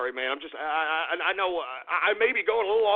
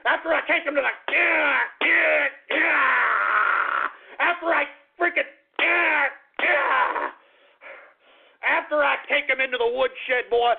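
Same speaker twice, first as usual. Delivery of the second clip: worked-up yelling; 6 semitones higher, slower than usual. Acted. Worked up, yelling between words.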